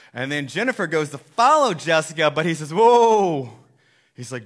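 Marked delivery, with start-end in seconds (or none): 2.7-3.5 loud, high "whoa"; mock halting shout. Jesus stopping Jennifer following Jessica, loud acted halt. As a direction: call out a theatrical stop.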